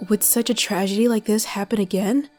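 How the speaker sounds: ordinary talk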